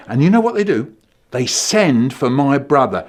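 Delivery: ordinary delivery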